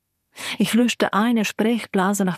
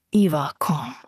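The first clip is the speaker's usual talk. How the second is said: conciliatory; 5 semitones lower than usual. Acted. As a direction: soothe her, lower and gentler. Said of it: "schlug Slava mit einem Mal einen versöhnlichen Ton an". (versöhnlich, tiefer und sanft)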